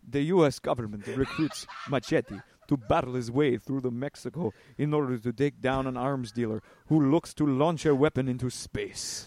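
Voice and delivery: movie trailer read in Spanish accent; laughing through a Spanish accent. Shown as a demonstration. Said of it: "do it in a Spanish accent".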